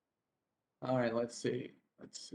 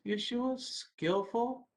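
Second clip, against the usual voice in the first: usual speaking voice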